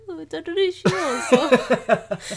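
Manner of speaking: high, drawn-out Italian accent; hammed-up singsong Italian, on the edge of laughing. Shown as a demonstration. Showing off to partner what Italian they could do, mock Italian accent. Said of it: "is Italian on there? I could be like".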